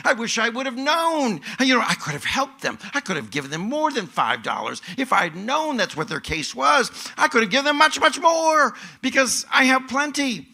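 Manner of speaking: high, loud, heartfelt wishing; fervent regret. Acted. Imagined victim wishing to have helped the thief, fervent. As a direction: build to a high, loud outpouring of generosity.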